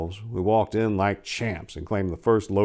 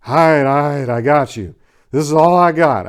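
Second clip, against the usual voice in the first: placating; 5 semitones higher, 8 dB louder than usual. A narrator's reading. Hands up at gunpoint.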